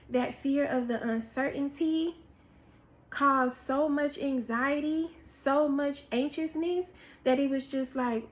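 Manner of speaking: ordinary talk, nothing marked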